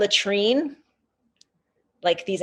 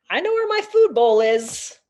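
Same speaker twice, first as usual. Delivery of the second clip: high-pitched, lightly voiced carefree dog; goofball pet dog; 5 semitones higher, 4 dB louder than usual. Pampered dog bragging about food, lightly voiced goofball. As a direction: speak lightly as a silly pet. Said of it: "like, a complete goofball".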